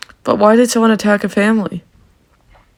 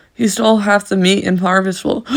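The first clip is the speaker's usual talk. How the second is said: ordinary talk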